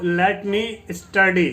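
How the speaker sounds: très lentement, chaque syllabe détachée; slow model reading. Shown as a demonstration. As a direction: spell it out slowly, ending down on study. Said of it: "teacher models the English phrase".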